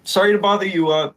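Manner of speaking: high, bright stranger voice; high-pitched woman asking after Keith; lady in red. Acted. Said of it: "She goes".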